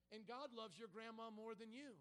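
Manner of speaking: ordinary delivery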